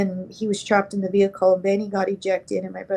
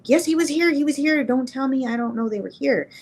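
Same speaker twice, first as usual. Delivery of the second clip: retold insistence; 5 semitones higher than usual. Patient just out of a coma insists on what he saw, retold insistently. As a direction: insist urgently, voice jumping up then easing.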